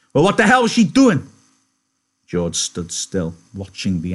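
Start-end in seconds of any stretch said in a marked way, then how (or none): 0.1-1.3 high, loud outburst; angry demand. Acted. Angry little man interrogating George, high and loud. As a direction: demand an answer at a furious pitch.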